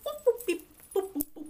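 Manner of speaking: high little boops; cartoon walking noises. She walks away off stage, cartoonish boops. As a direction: toot out her footsteps in a high voice.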